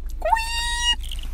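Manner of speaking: very high squeaky squeep; tiny high squirt noise; squirt sound. Retold or imitated. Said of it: "And you just go".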